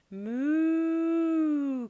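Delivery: long, high, loud moo; cow moo. Acted. Tom moos at cow.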